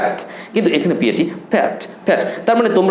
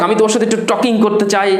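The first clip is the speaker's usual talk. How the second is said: usual speaking voice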